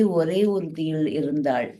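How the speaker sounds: ordinary delivery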